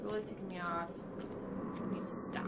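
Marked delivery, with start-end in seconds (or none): 1.2-2.5 whisper. Real, not acted. Calming himself down.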